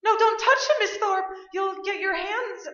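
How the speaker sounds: loud, high panicked calls; alarmed shouting. Acted. Nurse shouting in panic over the injured doctor. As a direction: cry out in alarm over the fallen man.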